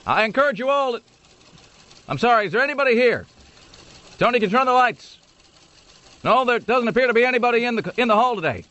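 hammed-up Obama rally to an empty room; mock Obama in an empty hall. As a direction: play Obama addressing a hall nobody came to. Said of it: "I'm picturing just a big sort of echoing hall".

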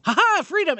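high, loud taunting squirrel voice; a cheeky squirrel's victory cry; gloating squirrel. Retold or imitated. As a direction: crow it as the squirrel escapes. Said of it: "the squirrel would go".

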